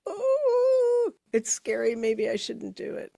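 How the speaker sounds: high-pitched "Oh" of mock fear; hammed-up worry, starting very high then easing down; mock scared. Speaker imitates the natural reaction to a risky step, mock frightened. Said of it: "might be more comfortably and naturally".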